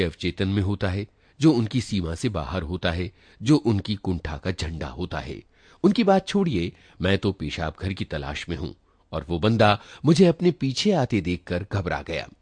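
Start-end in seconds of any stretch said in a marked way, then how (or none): none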